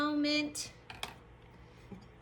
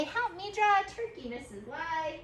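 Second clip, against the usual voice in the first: imitated pupil; 4 semitones higher than usual. Pupils ask teacher for drawing help, imitated plea. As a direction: play a pupil asking for help. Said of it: "some of you are gonna say".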